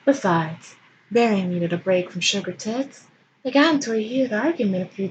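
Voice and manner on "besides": plain reading voice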